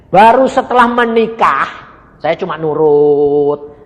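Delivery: jauh lebih keras dan lebih tinggi, lalu melambat; berseru keras. Real, not acted. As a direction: serukan seperti pengkhotbah yang menggebu. Khotbah memuncak. (pendeta berseru kepada jemaat, keras dan lambat)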